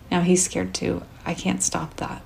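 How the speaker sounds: plain reading voice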